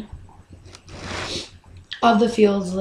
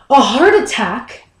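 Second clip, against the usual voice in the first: louder, disbelieving repeat; incredulous outburst; 2 semitones higher, 5 dB louder than usual. Acted. Son repeats the heart attack news to Mom, incredulous. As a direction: burst out in disbelief.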